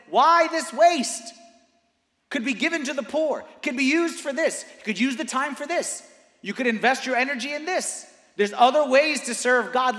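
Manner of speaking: high, loud preaching protest; impassioned objecting voice. Acted. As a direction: argue back at full preaching force.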